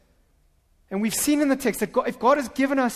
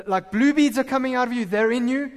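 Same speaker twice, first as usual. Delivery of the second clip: ordinary delivery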